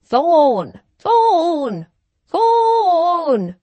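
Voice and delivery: loud, high calls rising each time; loud shout. A narrator's reading. Sick man shouts for the doctor from his bed, loud. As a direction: bellow the name from the sickbed. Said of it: "shouted the sick man ... so loudly".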